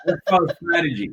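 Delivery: fast, laughing through it; laughing repeat. Real, not acted. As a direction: rush it out while cracking up.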